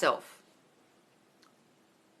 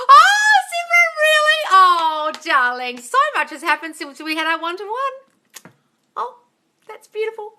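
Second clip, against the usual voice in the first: very high, loud, stretched-out delighted gasp; thrilled squeal; 12 semitones higher, 8 dB louder, slower than usual. Real, not acted. Host to a viewer whose partner said he loves her, a very high, loud thrilled squeal. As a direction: squeal with joy for her and draw the words out slowly. Reacts to viewer's love news.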